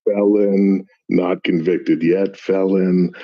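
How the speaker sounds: slow deliberate correction. Real, not acted. Correcting with careful emphasis.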